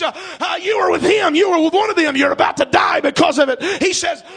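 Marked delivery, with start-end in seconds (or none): 0.6-3.6 loud, higher accusation; accusing shout. Acted. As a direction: throw it at him as a threat. Accusers confront Peter.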